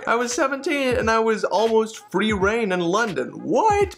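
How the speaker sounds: excited. Real, not acted. Excited memory of London.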